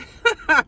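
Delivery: a sudden high, loud laugh at her own joke; short giggling burst; burst of laughter. Real, not acted. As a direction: laugh out, caught off guard. Laughs at own rave example.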